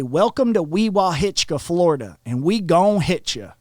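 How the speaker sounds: a hint of backwoods menace, pushed up and loud. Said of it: "four or five redneck hillbilly".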